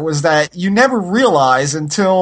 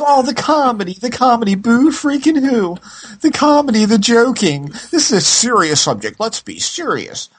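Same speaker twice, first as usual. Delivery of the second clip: mocking whiny critic; 8 semitones higher than usual. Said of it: "everybody says".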